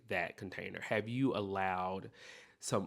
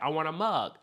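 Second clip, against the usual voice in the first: demanding customer; 6 semitones higher, 5 dB louder than usual. Retold or imitated. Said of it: "She was like".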